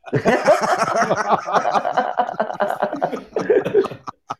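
long, high-pitched burst of laughing; helpless extended laughing fit; laughter. Real, not acted. Host cracking up at the caller from England, long laughter. Reacting to obvious English accent joke.

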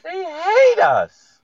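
high, slow wailing; mock wail. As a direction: cry it out high and drawn.

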